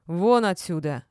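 loud, barked order; angry bark. A narrator's reading. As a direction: throw them out with one bark. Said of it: "рявкнула я". (рявкнуть сердито)

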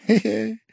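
high little sneering snicker; a petty man's snigger; sly giggle. A narrator's reading. Pavel Pavlovich sniggering at Velchaninov, high and sly.

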